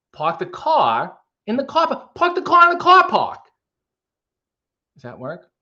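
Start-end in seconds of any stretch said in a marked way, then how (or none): 0.1-2.1 halting Boston accent, broken by pauses; higher-pitched, stop-start impression with dropped r's; Christopher Walken. Shown as a demonstration. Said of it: "went a little Christopher Walken there". 2.1-3.4 very high-pitched, exaggerated Boston accent; hammed-up Boston twang, way up high. Shown as a demonstration. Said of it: "in the Boston accent".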